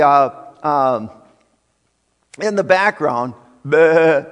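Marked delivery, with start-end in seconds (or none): 3.6-4.2 loud, held sheep's "baa"; imitated sheep bleat. Preacher mimicking the sheep behind Saul, loud bleating. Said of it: "you can just hear the".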